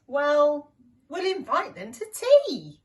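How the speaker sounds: loud, high bright announcement; proud of the clever plan; excited scheme. Acted. Shifty announcing his tea party plan, loud and high.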